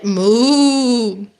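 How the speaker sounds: long drawn-out moo; rounded, held cow call; cow's moo. A narrator's reading. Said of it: "Cow said".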